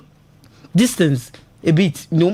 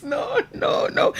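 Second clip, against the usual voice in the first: whispered no; 5 semitones higher, 5 dB quieter, much slower than usual. Real, not acted. Breathy emphatic refusal.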